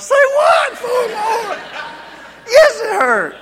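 high, loud startled cry of say what; mock alarm, voice jumping up; shocked yelp. Preacher retells shock at four more.

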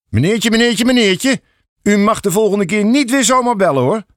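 high, scolding policeman, wagging-finger tone; stern scolding. A narrator's reading. Police officer scolding the father, stern. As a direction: tell him off like a naughty child. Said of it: "spreekt de agent mijn vader streng toe".

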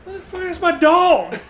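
high-pitched indignant outburst; mock outraged owner. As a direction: play the outraged dog owner. Voicing angry dog owner.

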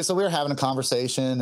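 ordinary talk

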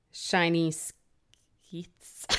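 "shiny skeets" whispered slowly, unsure of "skeets"; slow hushed try at "skeets"; tentative whispered "skeets"; unsure pronunciation. Shown as a demonstration. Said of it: "How do you pronounce that?".

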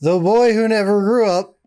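grand announcer voice. Acted. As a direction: announce it like a title. Peter Pan costume, announcing himself.

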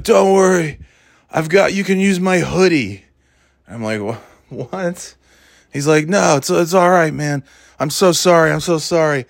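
imitating drunk fan. Drunk fan apologizing for spilled beer.

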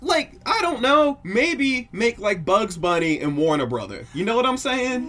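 excited. Real, not acted. Excited indignant reaction.

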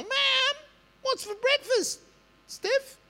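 put-on very high child's voice calling to mom; whiny child. Child calling to mother about breakfast, high whiny kid voice. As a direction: play a kid nagging for breakfast.

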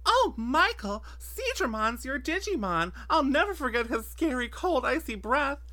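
high, loud, dreamy; dramatic gushing. Retold or imitated. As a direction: swoon over the scary breath. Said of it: "she's like".

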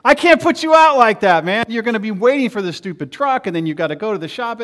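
high, loud, overdone politeness; eager protest. Retold or imitated. Preacher replaying his protest to his neighbor, high and loud. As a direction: protest a bit too much, pitch up.

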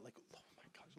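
barely breathed oh my gosh; awed whisper. Real, not acted. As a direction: whisper it in disbelief. Awed reaction to reveal.

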